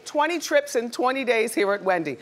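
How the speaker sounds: big game-show host intro; booming prize announcer. Acted. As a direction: sell the giveaway to the crowd. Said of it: "time to play Twenty in Twenty".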